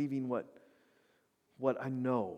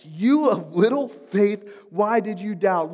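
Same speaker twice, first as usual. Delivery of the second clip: mock indignation; 8 semitones higher, 14 dB louder than usual.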